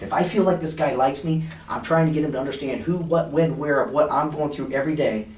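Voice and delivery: usual speaking voice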